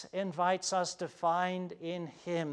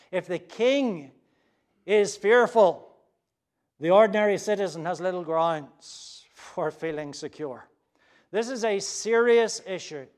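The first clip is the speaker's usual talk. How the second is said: louder, weighty pulpit emphasis; emphatic preaching; 2 semitones higher, 11 dB louder than usual. Acted. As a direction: drive the warning home.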